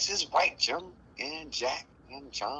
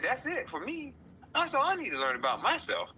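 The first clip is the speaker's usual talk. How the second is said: smug mock. Mocking self-satisfied person.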